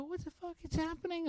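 high, fast disbelief; incredulous outburst. Retold or imitated. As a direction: act out exasperated bewilderment. Retells bewildered outburst at the studio.

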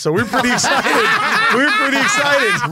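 loud, high, laughing through the words. Real, not acted. Teasing the guest who said yes to the cruise, laughing. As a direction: crack up while saying it. Joking about the only guest accepting.

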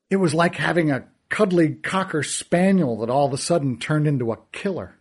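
ordinary delivery, nothing marked

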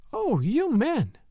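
high, drawn-out exasperation; exasperated cry. A narrator's reading. Wife despairs of her husband's blindness, crying out exasperated. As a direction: cry it slowly in wifely despair. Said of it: "cried his wife".

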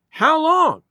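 high-pitched anxious demand; alarmed protest. A narrator's reading. Old lady questioning the delay, alarmed. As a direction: pipe up in alarm.

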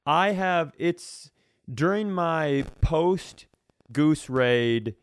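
ordinary talk, nothing marked

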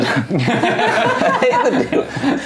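high, slow, laughing through it; laughing repeat. Acted. Lucius repeats his line, cracking up laughing. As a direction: drag it out through a giggle.

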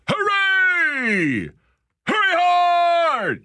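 high, loud, drawn-out skip yell; curling skip yelling. Shown as a demonstration. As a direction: belt it down the ice like a skip. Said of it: "you gotta go".

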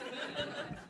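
laughing. Real, not acted. Host repeats through laughter.

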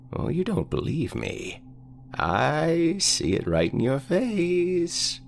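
put-on high, slow, quavering old man. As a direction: croak it like a frail old man.

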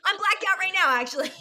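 deadpan joke that breaks into laughter; laughing joke. Real, not acted. Joking while laughing.

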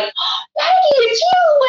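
re-enacted high squeal of delight; excited recognition. New mother seeing her midwife appear, squealing with delight. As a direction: greet someone beloved, pitch way up.

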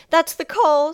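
high, loud, tearful cry; sobbing. A narrator's reading. Circe hears blows on the door, sobbing in fear. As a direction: wail it in fear. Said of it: "Circe sobbed".

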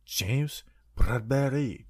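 slow, whispered French accent on the name. Shown as a demonstration. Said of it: "I don't know why I did a French accent".

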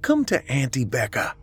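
put-on high, delighted call to a small child; gleeful auntie beckoning a toddler. A narrator's reading. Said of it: "she shouted gleefully".

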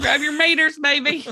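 high, lightly voiced Southern mom; a hint of a Southern mother; Southern mom accent. Imitating her mom's Southern accent, lightly voiced. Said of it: "That's what my mom sounds like".

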